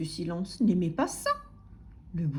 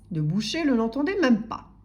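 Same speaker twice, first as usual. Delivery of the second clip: plain reading voice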